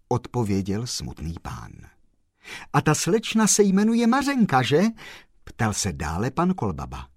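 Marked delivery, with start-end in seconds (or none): 2.7-4.8 eager high question. Acted. Postman eagerly guesses name.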